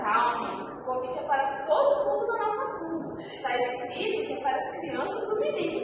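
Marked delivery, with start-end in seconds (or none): none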